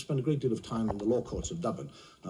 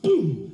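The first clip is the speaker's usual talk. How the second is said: loud, high "boom"; imitated thump; 10 semitones higher, 8 dB louder than usual. Storyteller as the stick hits the duck, explosive boom. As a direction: punch out a sudden loud boom.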